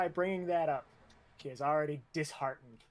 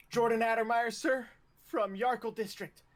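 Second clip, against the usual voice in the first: loud, higher-pitched cadet announcement; reporting recruit; 5 semitones higher, 5 dB louder than usual. Acted. Recruit answers the drill instructor, loud formal report. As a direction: call out name and district to a superior.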